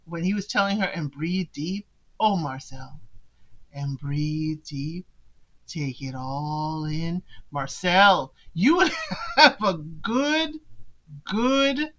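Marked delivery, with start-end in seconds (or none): 0.9-1.9 soft, slow, lower mock meditation guide; imitating Marcel's meditation voice. Mimicking Marcel guiding Jen's meditation, softly and slowly. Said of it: "Marcel's voice, I don't know what it is". 3.7-7.2 soft, slow, lower mock meditation guide; imitating Marcel's meditation voice. Mimicking Marcel guiding Jen's meditation, softly and slowly. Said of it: "Marcel's voice". 8.5-11.9 slow words broken by a laugh. Real, not acted. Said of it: "A C M R videos".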